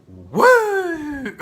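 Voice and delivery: high, loud wrestler's woo; hammed-up Flair woo; Ric Flair woo. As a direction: scream it like Ric Flair's trademark.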